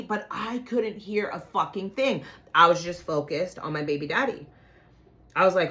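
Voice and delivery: ordinary talk, nothing marked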